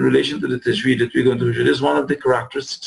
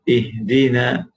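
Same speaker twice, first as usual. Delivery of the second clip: ordinary delivery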